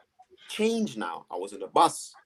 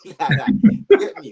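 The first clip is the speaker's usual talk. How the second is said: breaks into a laugh mid-line; real laughter while telling the story; 9 semitones higher, 10 dB louder than usual. Real, not acted. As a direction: laughs the words out, high and loud. Laughing at the bus memory.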